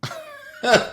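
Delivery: high squeal of a laugh in disbelief; real laugh at the mentor's reply; incredulous laugh. Real, not acted.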